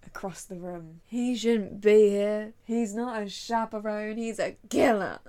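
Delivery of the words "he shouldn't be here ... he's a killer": loud, slurred accusation; hammed-up drunken outrage, punching the key words; drunk Emily. Emily sees the murderer across the room, drunkenly outraged. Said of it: "they immediately realise that she's drunk".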